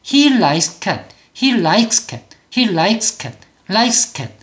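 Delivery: he likes cat with likes ending in tight "ks"; no vowel inside or after likes; one-syllable likes. Shown as a demonstration. Teacher drills the right way, one-syllable likes. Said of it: "이렇게 소리를 이름절로 낸다는 거".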